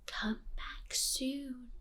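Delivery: slow unvoiced whisper; slow soft creepy whisper; mouthed. A narrator's reading. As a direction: barely breathe it, slowly. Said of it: "Her pale lips mouthed".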